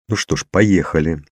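plain reading voice

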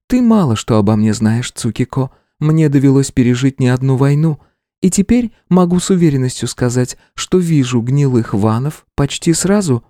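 high, steady female voice; older woman voice. A narrator's reading. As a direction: speak as a seasoned woman warrior. Rangiku voiced by male narrator.

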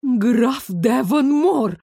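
slow, drawn-out loathing; hateful. A narrator's reading. Elisa recognizes the cruel inspector, hateful. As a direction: spit out the name slowly with hatred. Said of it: "с ненавистью процедила Элиза".

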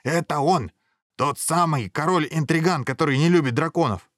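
high, emphatic denunciation; accusing outburst. A narrator's reading. Dragon head interrupting to accuse the king, high and emphatic. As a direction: point out the culprit to all. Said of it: "вмешалась голова Пётр".

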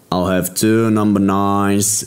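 slow, deliberate English order at the bar; blurted order. Acted. Brzoza orders drinks from the barman, slow and deadpan. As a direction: drawl each word out like a meme. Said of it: "wypalił Brzoza".

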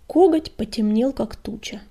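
plain reading voice

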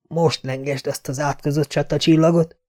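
a hint of grim defiance; quiet defiant taunt; defiant challenge. Dunk defies the prince.

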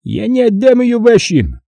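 high-pitched, resolute character voice; protective brother. A narrator's reading. Vakha refuses to give up his sister, resolute.

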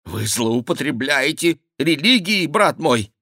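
flustered king's complaint; indignant protest. A narrator's reading. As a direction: object in a scandalised high voice. King protests to monk.

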